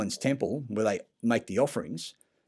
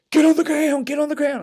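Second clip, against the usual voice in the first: high, loud barked command; shouted police order; 9 dB louder than usual. Retold or imitated. Young officers ordering the naked man down, loud high shouting. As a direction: yell it like a panicky young cop.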